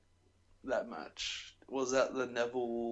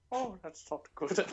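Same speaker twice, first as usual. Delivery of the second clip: higher put-on aside; Paul Bearer impression; 7 semitones higher than usual. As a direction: lightly mimic him out of character. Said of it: "That was Paul Bearer coming out of character".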